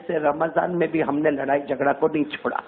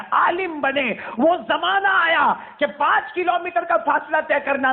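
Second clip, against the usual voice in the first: rising preaching; 10 semitones higher than usual. Acted. Preacher builds toward the triumph of Bayazid's fame. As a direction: build the sermon to a climax.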